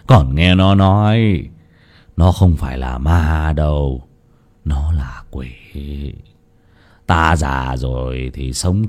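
put-on low, slow, heavy-hearted old man; weary sighing reply. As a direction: sigh it out as a resigned elder. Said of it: "thở dài đáp". (cụ già thở dài đáp lão Nhiên, giọng trầm, chậm, mệt mỏi)

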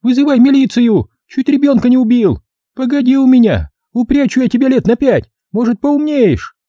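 high-pitched scolding woman; shrieking mother. Acted. Mother cursing drunks and calling granny, high yelling. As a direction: screech like an outraged mum. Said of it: "заорала она старухе".

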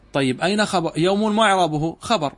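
ordinary delivery

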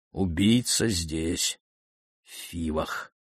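slow words sinking to very soft; hushed realisation. A narrator's reading. King learns the killer is in Thebes, hushed and slow. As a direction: let it dawn slowly, dropping away at the end. Oedipus stunned by the revelation.

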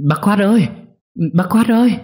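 high-pitched panicked call for help; frantic calling. A narrator's reading. Wife running to the neighbour for help, frantic calling. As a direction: call out urgently, the last word held high. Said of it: "gọi hớt hải".

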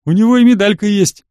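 high, loud sidekick voice; excited hanger-on, pitched well up; eager lackey. A narrator's reading. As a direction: pipe up like an eager toady. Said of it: "подскочил шестёрка".

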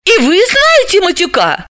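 very high astonished question; high-voiced startled heroine; surprised. A narrator's reading. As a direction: ask in amazement, pitch soaring. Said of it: "удивилась Далила".

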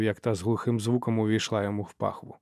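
usual speaking voice

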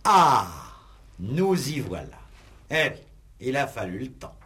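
exclamation triomphante, haute; triomphe. Acted. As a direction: tenir enfin le mobile. Juge triomphe à l'aveu. (juge triomphant)

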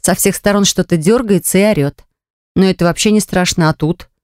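plain reading voice

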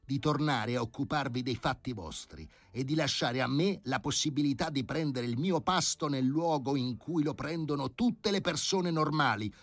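furious professor. A narrator's reading. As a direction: tell the committee off, furious. (professore furioso che rimprovera il comitato, più alto e forte)

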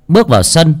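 plain reading voice

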